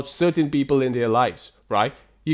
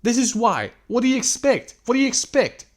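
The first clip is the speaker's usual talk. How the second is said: high-pitched incredulous challenge; exasperated; 7 semitones higher than usual. Real, not acted. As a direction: throw the question at them, voice raised high. Exasperated rant about such guys.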